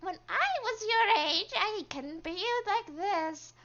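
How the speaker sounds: high, loud old granny put-on; old grandma voice. Shown as a demonstration. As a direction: croon it high like a hammed-up grandma. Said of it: "like, a old grandma voice".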